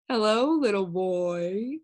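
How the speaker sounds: slow, smooth friendly stranger; oily polite gentleman voice; amiable gentleman. Acted. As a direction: greet him sweetly, slowly. Faceless man greets amiably.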